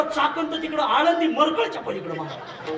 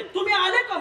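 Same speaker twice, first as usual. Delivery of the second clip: much higher wife voice; scolding wife; 12 semitones higher than usual. Retold or imitated. Jijabai greets her husband, much higher. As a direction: snap back as the wife. Wife's shrill retort.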